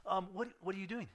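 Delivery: a flustered John stopping him; puzzled John. Retold or imitated. John confronting Jesus, retold.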